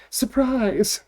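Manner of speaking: high, bright surprise yell; excited shout. Acted. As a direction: throw it out like a party reveal. Shouting the gift reveal.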